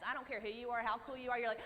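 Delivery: ordinary talk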